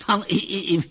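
high, laughing through the words; amused high-pitched speech broken by laughs; psychologist's laughter. A narrator's reading. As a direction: burst out giggling as you speak. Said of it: "Psychologův smích vytryskl".

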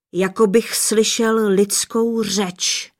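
ordinary delivery

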